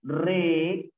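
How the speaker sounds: "re" held long, standing alone; drawn-out "re", then pause; syllable by syllable. Shown as a demonstration. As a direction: sound out "re" as a separate syllable. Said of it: "sería".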